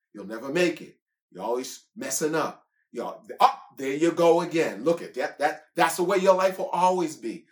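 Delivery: loud, jeering accuser; mock them like the devil; taunting devil. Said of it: "the devil will lie to you about yourself".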